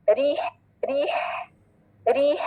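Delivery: री with light R and pure long ee; correct thin R. Shown as a demonstration. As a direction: model each री separately, ending on breath. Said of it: "पहले री वाली sound सही करें".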